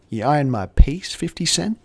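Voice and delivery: mock-aggressive cop challenge; retold cop jibe, rising in pitch; crazy aggressive cop. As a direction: taunt like a hostile cop, lightly acted. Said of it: "like, crazy aggressive".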